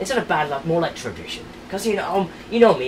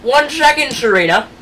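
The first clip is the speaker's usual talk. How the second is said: loud stalling call; 9 semitones higher, 9 dB louder than usual. Acted. Stalling the bride loudly.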